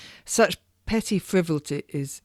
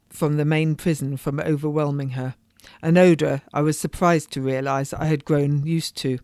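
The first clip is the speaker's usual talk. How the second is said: plain reading voice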